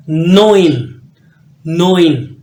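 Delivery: नोइन loud and clear, oi sound; German neun. Shown as a demonstration. Teacher corrects students on German nine, emphatic. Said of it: "ये नोयान नहीं है".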